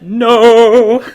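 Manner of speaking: loud, high hammed-up no; mock anguished cry. Listener echoes the prince in jest, hammed-up loud cry.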